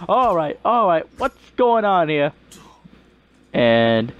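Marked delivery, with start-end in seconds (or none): 0.1-2.3 higher-pitched peacemaker voice; Zenyatta. Acted. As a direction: step in higher to break it up. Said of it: "Zenyatta is like".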